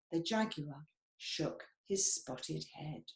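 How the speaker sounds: usual speaking voice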